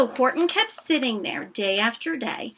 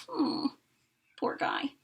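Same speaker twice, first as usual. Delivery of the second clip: whispered pity; 3 semitones higher, 9 dB quieter than usual. Real, not acted. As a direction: breathe it sympathetically.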